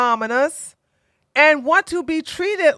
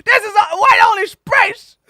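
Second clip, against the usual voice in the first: high, loud mocking bigot voice; a hammed-up gatekeeper, replayed; 9 semitones higher, 5 dB louder than usual. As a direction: repeat the snooty gatekeeper line. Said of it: "It's like".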